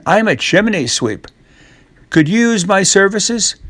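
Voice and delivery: chirpy sweep selling his services; lightly voiced Jack. Disguised Jack offers to clean the chimneys, eager.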